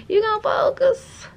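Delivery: high, incredulous question at the camera; exasperated at the camera. Real, not acted. Talking to a camera that won't focus, high and incredulous. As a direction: pitch it way up, scolding the camera.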